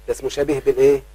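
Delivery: usual speaking voice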